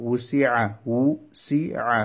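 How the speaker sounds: উসিআ very slow, in three parts: উ, সি, আ; syllable by syllable. Shown as a demonstration. Teacher breaking down a word for learners, syllable by syllable. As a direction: pull উসিআ apart with pauses.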